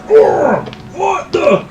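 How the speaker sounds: high, loud startled cry. Acted. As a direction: stagger and yelp in surprise. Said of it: "staggers forwards going".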